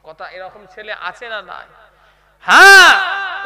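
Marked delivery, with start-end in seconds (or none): 2.4-3.4 drawn-out shout. Real, not acted. Preacher rouses the crowd.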